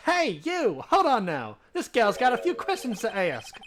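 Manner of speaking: loud, high Southern gal; southern accent. Acted. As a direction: holler it in a big Southern twang. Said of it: "I've heard that southern accent".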